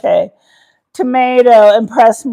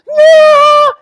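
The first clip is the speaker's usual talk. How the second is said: high, loud shout of panic; panicked yell; 7 dB louder than usual. Retold or imitated. Husband seeing dog jump out, panicked high shout. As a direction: yell like he's losing his mind. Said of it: "here's husband going".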